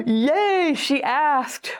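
gasp, then a high, elated squeal of relief; acted delight. Admirer thrilled that she asked him, acted delight. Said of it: "they're gonna be like".

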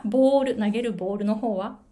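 usual speaking voice

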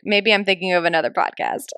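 normal talking voice

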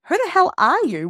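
high, loud disbelieving cry; astonished friend, loud and high; amazed outburst. Retold or imitated. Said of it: "I'm like".

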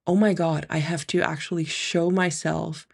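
usual speaking voice

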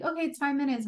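mock alarm that five minutes have passed; surprised self. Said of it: "then I'd be like".

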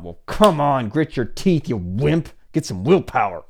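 imitating harsh self-scolding; mock tough coach. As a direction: bark a mock pep talk at a wimp.

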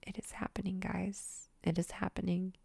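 excited secret whisper; awed whisper. Real, not acted. Telling viewers success is coming, excited whisper. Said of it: "I'm just like".

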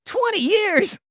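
high mock-shocked character voice. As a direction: tease him in disbelief. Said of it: "I'll do the Ty voice".

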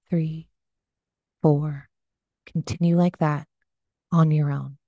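unhurried meditation voice with long pauses; gently pace them through breath and body, leaving space; guided meditation. Acted. Leads a body scan meditation.

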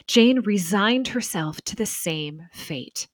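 measured, even read-aloud narration; documentary narration. Acted. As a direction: narrate the history calmly and evenly. Reads scripted documentary narration.

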